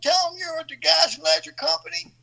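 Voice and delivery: put-on friend's father teasing about old pranks, laughing. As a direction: rib him like an old friend's dad. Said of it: "He's like".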